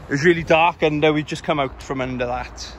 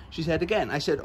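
normal talking voice

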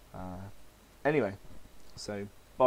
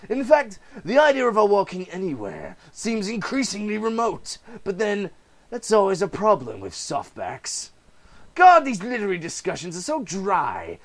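higher, louder mocking villain voice; sneering villain, pitched higher and louder; gloating Joker; 9 semitones higher, 9 dB louder than usual. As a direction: savour every cruel joke. Joker taunts Gordon.

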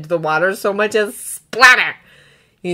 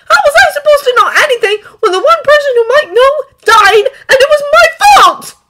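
high, loud distraught outburst; anguished snapping; 12 dB louder than usual. A narrator's reading. Boy to the dragons, blaming himself for a death, snapping in anguish. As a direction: shout it with a heaving chest. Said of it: "He snaps, chest heaving and hurting".

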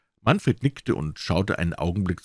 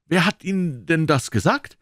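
sudden question; 9 semitones higher, 3 dB louder than usual. A narrator's reading. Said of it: "Plötzlich fragte er schnell".

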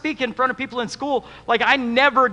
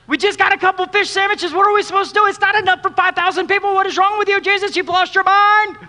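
high, loud disbelieving complaint to Jesus; a disciple throwing up his hands at the teacher; exasperated disciple; 9 semitones higher, 4 dB louder than usual. Retold or imitated.

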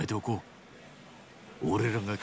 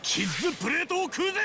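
excited. Acted. Triumphant declaration after whisper.

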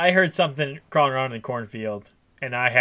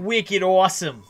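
put-on slow, louder Boston accent "Wicked awesome"; 6 semitones higher, 6 dB louder than usual. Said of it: "you gotta do a Boston accent".

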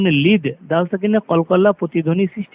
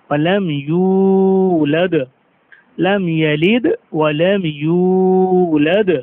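slow, drawn-out chanted recitation; slow tajweed recitation of আল্লাহু সমাদ; Quran recitation; much slower than usual. Shown as a demonstration. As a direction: recite it slowly with full tajweed. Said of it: "কলকলা মানে".

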